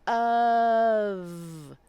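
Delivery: "Of" drawn out slowly, every sound heard; stretched "of". Shown as a demonstration. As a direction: say "Of" slowly and listen to each sound. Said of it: "listen to the sounds that come out of my mouth".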